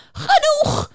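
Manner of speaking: very high, piercing shout; beaver screaming. Acted. The beaver screams at the singing unicorn, a shrill high yell. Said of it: "begint de bever te schreeuwen".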